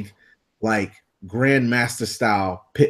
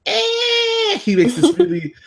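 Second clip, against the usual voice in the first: very high squeaky dog whimper; imitated dog whimper; slower than usual. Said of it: "he's like".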